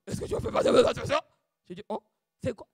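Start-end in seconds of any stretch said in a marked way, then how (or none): 0.1-1.2 fast, mock stern question; retold fiancé's stern question; imitating the fiancé. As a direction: put on his voice of authority. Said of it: "Comme il parle".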